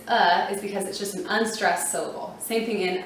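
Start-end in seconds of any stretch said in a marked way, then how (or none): 0.1-0.5 firm "uh" schwa; schwa sound. Shown as a demonstration. Teacher showing the A says uh. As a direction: give a short "uh". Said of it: "A at the end is".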